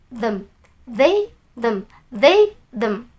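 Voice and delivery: "they", "them" softly, voiced th; modelled th pronunciation. Shown as a demonstration. Teacher repeats for learners, soft careful "they", "them". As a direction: drill "they" and "them" with dal-like th.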